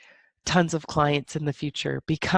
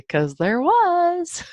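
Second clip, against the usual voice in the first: knowing admission; 13 semitones higher, 4 dB louder, much slower than usual. Real, not acted. Admitting judgment with a stretch.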